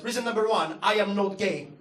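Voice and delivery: ordinary talk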